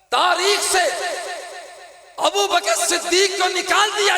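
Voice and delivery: high, loud impassioned preacher's cry; preaching at full force. Acted. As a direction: hurl the question at the congregation.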